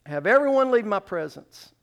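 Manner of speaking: high, loud commanding outburst; crying out. Acted. Joseph to all his attendants, crying out a command. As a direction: cry out an order to clear the room. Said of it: "he cried out".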